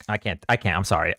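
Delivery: ordinary talk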